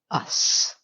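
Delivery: breathy whisper on the last word. Retold or imitated. Samantha telling Carrie who "we" means, in a whisper. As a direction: drop to a secret whisper for the punchline.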